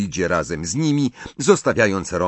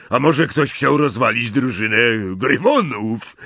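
worried Hagrid; 3 semitones higher than usual. A narrator's reading. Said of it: "zaniepokoił się Hagrid".

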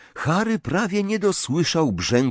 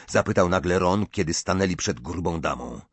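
plain reading voice

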